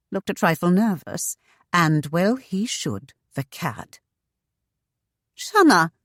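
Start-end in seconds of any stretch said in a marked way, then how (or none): 5.3-5.9 put-on bright, accented hello, high and loud; high, lively accented greeting. A narrator's reading. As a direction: call out to her brightly with an accent. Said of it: "greeted her brightly with that intriguing accent".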